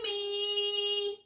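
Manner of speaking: high shouted name; narrated yell. A narrator's reading. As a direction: call her name up the stairs. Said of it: "yelled as loudly as he could".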